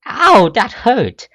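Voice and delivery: high, slow loud yelp; pained yelp. Acted. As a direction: cry out from the slap. Struck by a slap.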